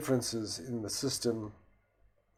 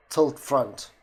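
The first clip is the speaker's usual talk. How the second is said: louder, higher command to a machine; 4 semitones higher, 8 dB louder than usual. Shown as a demonstration. Instructing the system to tilt, loud voice command. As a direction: call out each word firmly.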